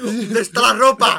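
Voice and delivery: loud, slow, grabbing her by the neck; mock stewardess shouting the demand; mock furious demand. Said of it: "Cogiéndola del cuello". (azafata furiosa exigiendo a gritos)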